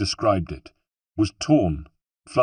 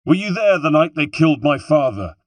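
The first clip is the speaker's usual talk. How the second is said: high, loud demanding question; impassioned shout, pitched well up; shouted accusation; 8 semitones higher, 7 dB louder than usual. A narrator's reading. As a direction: shout it after the man you chase. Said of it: "I shouted at him".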